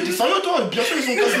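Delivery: high-pitched mock disbelief, laughing; incrédule. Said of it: "il regarde comme ça en mode". (père incrédule imité, voix qui monte)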